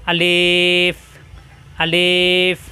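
আলিফ, slow, held clearly, lips never closing; Alif pronunciation demo. Shown as a demonstration. Teacher to students, showing how আলিফ is pronounced, with lips apart. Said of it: "দুই ঠোঁট একত্র হবে না".

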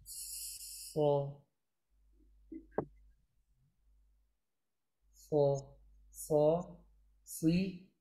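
سا and سی said softly and carefully; soft, careful model syllables; teacher demo syllables. Shown as a demonstration. Teacher models letters on camera for students, soft and careful. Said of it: "تصویر من ببینید".

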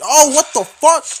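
loud, high burst of disbelief; shocked yell. Real, not acted. Gamer shrieking after getting knifed. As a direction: scream it like you just got killed. Sudden death in game, shocked.

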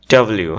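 "ডাব্লিউ" said neatly as dab-o-liu; correct pronunciation. Shown as a demonstration. Teacher giving the new way from now on, correct pronunciation. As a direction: model "ডাব্লিউ" with a light middle vowel. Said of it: "এখন থেকে কীভাবে উচ্চারণ করব".

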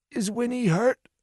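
high, thin voice of an injured man; weak wounded man. A narrator's reading. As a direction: ask it faintly, like someone barely conscious.

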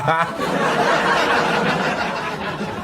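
silent breathy high laugh; helpless giggle, no voice; high wheezing laugh. Real, not acted. Laughing at own story.

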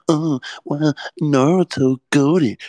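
mock the smug anime fan. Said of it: "No, bitch, shut the fuck up".

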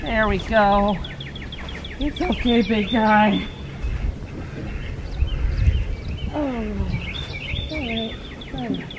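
soft, gentle reassurance to an animal; soothing. Real, not acted. Freeing a turkey from the fence, soothing.

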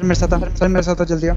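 loud urgent call to follow; shouting to teammates. Real, not acted. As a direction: yell for the squad to come.

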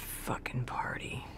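whispered, under the breath; a whispered groan of regret; whispered curse. Acted. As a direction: hiss it out in a whisper. Remembering the party, muttered.

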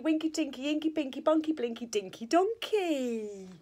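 ordinary delivery

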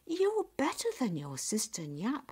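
a mother approving of her daughter; high, approving storybook mum; praising mother. Acted.

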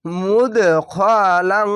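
মুদখালাং chanted slow and loud, দ bounced, ং nasal held; recitation with qalqala. Shown as a demonstration. Teacher demonstrating qalqala and ikhfa. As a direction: echo the দ and hum the ং in মুদখালাং. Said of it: "আর এখানে কলকলা".

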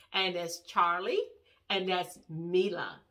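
ordinary talk

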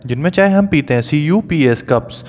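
ordinary delivery

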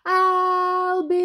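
long, high, loud call; angry sister hollering; yelled. Acted. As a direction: yell the name, holding it out. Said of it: "yelled Mary".

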